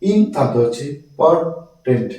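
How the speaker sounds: slow "ইম", then "পর্টেন্ট" in separate parts; split into syllables. Shown as a demonstration. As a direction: pause after "ইম" and break up "পর্টেন্ট". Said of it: "একটা উচ্চারণ করতে পারি না".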